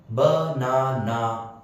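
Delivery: banana drawn out as ba-na-na; three slow, separated beats on banana; syllable by syllable. Shown as a demonstration. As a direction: break banana into ba, na, na. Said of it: "three syllables".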